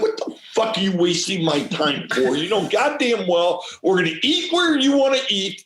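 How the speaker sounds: retold rant building from loud to a high half-laughing shout; hammed-up fed-up husband yelling, laughing by the end; mock angry rant. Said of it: "And I'm like".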